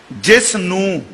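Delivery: ordinary delivery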